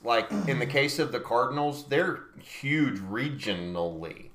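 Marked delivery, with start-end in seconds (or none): 3.0-4.3 slow, drawn-out syllables. Real, not acted. Co-host explaining the Cardinals' reach, slow and drunk-sounding. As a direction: stretch REGIONALLY out slowly, low. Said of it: "how he said that drunk".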